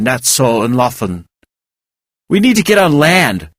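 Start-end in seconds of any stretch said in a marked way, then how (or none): 2.3-3.5 urgent. A narrator's reading. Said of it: "Val said urgently".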